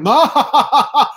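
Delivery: high, loud mock evil cackle; maniacal villain laugh. Standing in his hurricane's damage, maniacal laugh. Cackles as storm master in street.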